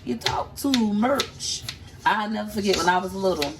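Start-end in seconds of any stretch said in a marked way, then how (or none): 0.0-1.6 slow, higher scolding; a scolding teacher, slowed right down; mock teacher scolding a chatty kid. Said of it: "I just talked too much".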